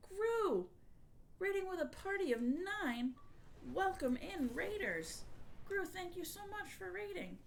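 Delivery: high, bright raid welcome; cute anime streamer. Real, not acted. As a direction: squeal a welcome to the raiders.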